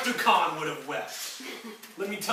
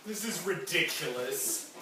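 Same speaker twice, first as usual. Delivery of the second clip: ordinary talk, nothing marked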